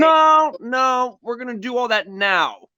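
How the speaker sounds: high mocking quote of the heroine; hammed-up mock of the heroine. Mocking the character's line.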